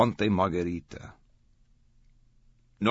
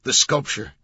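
higher, louder insistence; urging; 6 semitones higher, 7 dB louder than usual. A narrator's reading. Langdon urges the guard to describe the sculpture, urging. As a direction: urge him on. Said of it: "Langdon urged".

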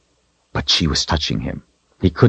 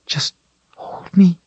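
high, strained, holding back tears; choked plea. A narrator's reading. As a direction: squeeze the words out. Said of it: "She could hardly get the words out".

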